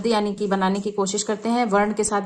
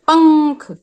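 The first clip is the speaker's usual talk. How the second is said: पंख called out louder and higher; emphatic demo word; 5 semitones higher, 8 dB louder, much slower than usual. Shown as a demonstration. Teacher calling out पंख for children. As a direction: say पंख loud and bright with the nasal clear. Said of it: "इस तरह से इसको बोलने पे".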